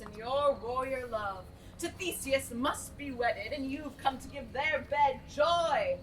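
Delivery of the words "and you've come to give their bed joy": ordinary talk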